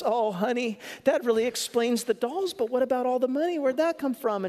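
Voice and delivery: overjoyed, rushing the question; a delighted husband asking his wife about the money; happy husband. Retold or imitated. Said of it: "he burst into happiness".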